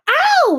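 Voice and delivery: high, loud yelp of pain; howl of pain. A narrator's reading. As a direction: howl as if pricked. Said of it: "he howled".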